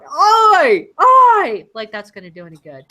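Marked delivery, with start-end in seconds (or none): 0.0-1.7 high, loud imitated holler; calling after. Co-host imitating Catherine chasing the van, mock shouting. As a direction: yell after a departing van, hammed up. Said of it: "running after the van going".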